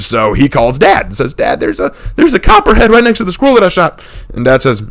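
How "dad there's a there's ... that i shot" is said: high, hurried breathless report of the snake; a kid rushing to tell Dad; excited kid brother. Retold or imitated. Brother phoning Dad, high and excited.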